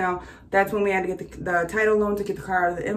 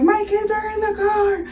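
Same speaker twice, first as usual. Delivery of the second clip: very high, stretched-out plea; high mock scream, words held long; retold scream; 12 semitones higher than usual. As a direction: re-enact screaming for the kids without real volume. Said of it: "I'm literally screaming".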